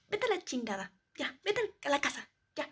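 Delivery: retold angry mother. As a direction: play the furious mom, building as it goes. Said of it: "me gritó y me dijo".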